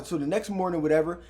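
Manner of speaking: ordinary delivery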